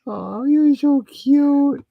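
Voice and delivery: very high, very slow cooing. Real, not acted. Cooing at Princess the dog, high slow baby talk. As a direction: melt over the puppy.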